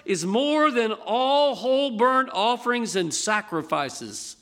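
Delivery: slow, weighty scripture reading to the congregation. Acted. Preacher reads Mark twelve to the congregation, solemn and measured. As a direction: let each verse land with gravity.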